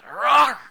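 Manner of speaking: sudden loud, high-pitched roar; imitated dog roar. Preacher imitates the dog that suddenly turned on dad, roaring. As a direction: burst out roaring like an attacking dog. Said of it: "the dog just goes".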